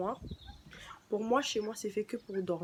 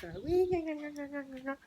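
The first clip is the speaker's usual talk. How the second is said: mock gna sound; 7 semitones higher than usual. Mimicking a snap sound.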